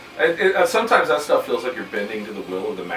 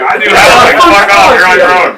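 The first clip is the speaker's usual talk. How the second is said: mock shout; 7 semitones higher, 14 dB louder than usual. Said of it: "He's like".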